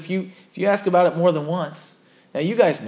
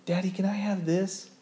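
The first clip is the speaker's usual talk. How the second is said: put-on soft, sweet child's ask; little daughter asking; 4 semitones higher, 7 dB quieter than usual. Preacher imitating his little daughter asking for something. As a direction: ask like a small daughter, softly. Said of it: "my little daughter".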